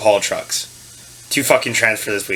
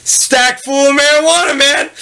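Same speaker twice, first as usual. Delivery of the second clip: very high, loud, drawn-out boast; brazen retold answer; 4 dB louder, slower than usual. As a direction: sing it out high and slow, playing up your own cheek. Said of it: "I was like".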